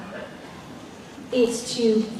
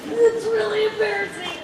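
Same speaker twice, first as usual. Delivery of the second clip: very high, small squirming voice; embarrassed squeak; 11 semitones higher, 3 dB quieter than usual. Real, not acted. Speaker about to confess an embarrassing first meeting, high embarrassed squeak.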